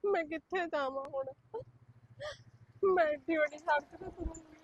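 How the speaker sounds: tearful, breaking voice; woman thrown out of the house, crying. Acted. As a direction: weep the words out. Homeless widow weeping alone.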